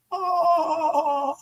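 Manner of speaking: high cartoon chuckle; a quick goofy laugh way up high; Shaggy laugh. Shrugging off the embarrassing scene, a high goofy laugh.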